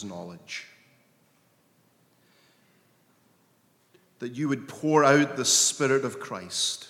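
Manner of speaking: earnest prayer. Acted. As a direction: pray it, longing and unhurried. Leads congregation in prayer.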